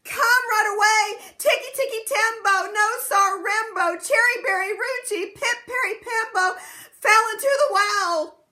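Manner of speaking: high, loud urgent call; urgent shout. Acted. Chang calling the old man.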